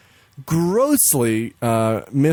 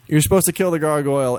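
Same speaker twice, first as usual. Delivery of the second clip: ordinary talk, nothing marked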